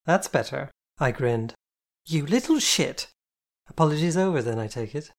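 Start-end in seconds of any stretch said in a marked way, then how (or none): 2.1-3.1 mock outrage. Soaked friend yells back.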